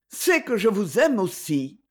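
usual speaking voice

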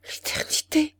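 whispered in horror, barely voiced; terrified murmur. A narrator's reading. A widow repeats the devil's word in terror, whispered. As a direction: breathe it out, aghast. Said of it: "Elle murmura avec épouvante".

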